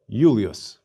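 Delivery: Julius as YOO-lee-us. Shown as a demonstration. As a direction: start "Julius" with a Y sound, no English J. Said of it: "We pronounce the initial I".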